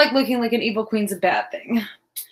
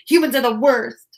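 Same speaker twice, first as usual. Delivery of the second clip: ordinary talk, nothing marked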